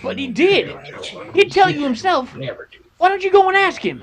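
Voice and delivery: a frightened prisoner insisting his story is true; desperate pleading. Acted. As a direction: push harder with each plea to be believed.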